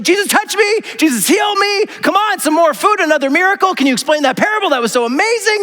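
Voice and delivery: high, excited pleading crowd; eager fans. Retold or imitated. Crowd clamoring for Jesus, high and gushing. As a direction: gush at Jesus like a fan.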